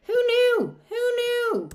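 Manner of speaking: squeaky high "who knew"; playfully amazed, sing-song; high mock surprise. Real, not acted.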